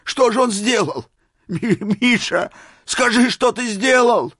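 very high, agitated demanding; excited shout. A narrator's reading. Old man begging Misha to tell, shouting. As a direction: stammer and cry out, desperate to know. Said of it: "закричал, вскакивая".

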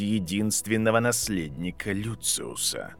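a hint of surprise, low; Snape voice. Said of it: "с некоторым удивлением спросил Северус". (низкий голос Снейпа)

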